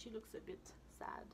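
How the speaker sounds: soft sad whisper; hushed sympathetic aside. Real, not acted. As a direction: whisper it gently. Commenting on picture, hushed.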